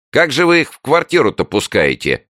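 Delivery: high, loud protest; object, scandalised; indignant neighbour. A narrator's reading. Neighbour protests even more. (возмущённый голос соседки)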